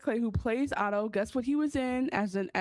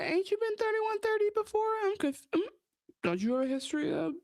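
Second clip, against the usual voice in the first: high, sassy, pointed questioning; mock sassy challenge; 8 semitones higher than usual. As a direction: needle him with high sass. Acting out a character's challenge.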